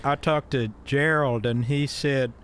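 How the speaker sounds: put-on folksy Southern old-timer; Southern accent. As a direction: spin it out in a Southern twang. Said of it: "You did practice that voice".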